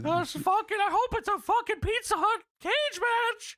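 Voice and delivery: put-on high, strained old-man squeak; hammed-up high Paul Bearer whine; squeaky Paul Bearer voice. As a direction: squeal it like a pathetic old ghoul. Joke in the squeaky voice.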